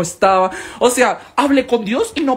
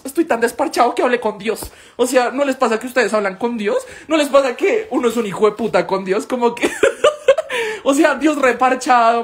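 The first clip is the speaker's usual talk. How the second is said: normal talking voice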